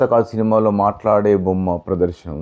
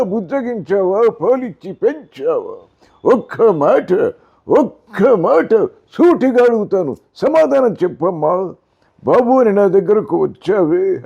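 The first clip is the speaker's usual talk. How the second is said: much higher, louder, slow thundering stage dialogue; NT Rama Rao as Karna; 13 semitones higher, 4 dB louder, slower than usual. Retold or imitated. Karna demanding answers from mother Kunti, booming dramatic dialogue. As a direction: declaim it like an epic film hero confronting his mother. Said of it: "Ramarao గారు కర్ణుడిగా".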